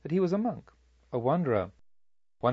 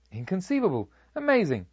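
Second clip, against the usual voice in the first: astonished; 6 semitones higher than usual. A narrator's reading. Archer amazed his arrows missed.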